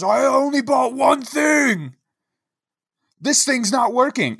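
high, slow exasperated yell; indignant shout. Retold or imitated. Old man yelling at the grocery clerk, high exasperated shout.

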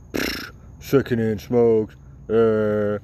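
slow, scoffing put-on voice of an idiot; mocking a dismissive smoker. Mocking the idiots who dismiss secondhand smoke, slow scoffing voice. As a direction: drag it out with a sneering pfft. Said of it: "those idiots that are like".

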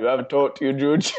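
mock teasing. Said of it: "That's what we would say".

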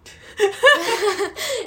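high crying laugh. Real, not acted. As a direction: laugh till it hurts. Laughing at her own answer.

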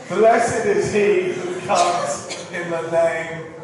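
loud, very slow proclamation; drawn-out, ringing pulpit quotation; proclaimed blessing. Acted. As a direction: declare scripture out to the congregation. Quotes the welcome in scripture.

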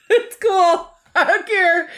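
giggling way up high; laughing high. Pretend. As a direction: squeal it out through laughter. Laughing mock indifference.

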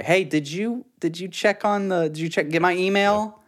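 high-voiced mom delaying the goodbye. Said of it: "say bye to my mom".

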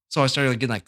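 ordinary talk, nothing marked

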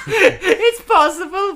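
high, loud laughing reply; slow, high laughing aside. Real, not acted. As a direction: laugh through the words. Laughing at spit joke.

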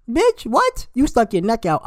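can't believe what she just heard; incredulous shout at her friend; outraged disbelief. Real, not acted.

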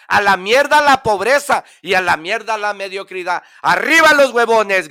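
loud, high defiant yell; lazy man shouting. Acted. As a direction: bellow it like a rally chant. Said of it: "gritaba el perezoso".

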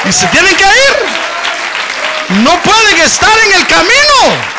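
high, loud, slow preacher's cry; fiery preaching. Acted. Pastor declares victory to the congregation in full preaching cry. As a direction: proclaim it over the whole church.

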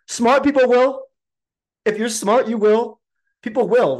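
high, loud, emphatic retort. Real, not acted. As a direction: fire it back with conviction. Countering the doubters.

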